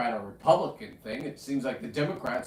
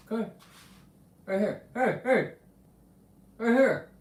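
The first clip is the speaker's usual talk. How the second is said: calling dog; 7 semitones higher than usual. Real, not acted.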